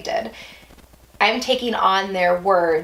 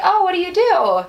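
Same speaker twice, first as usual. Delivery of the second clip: chirpy party question; 11 semitones higher, 5 dB louder than usual. Retold or imitated. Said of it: "people being like".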